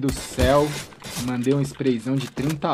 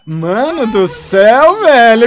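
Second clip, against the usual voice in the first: excited yelling; 8 semitones higher, 11 dB louder than usual. Real, not acted. Celebrating an amazing play.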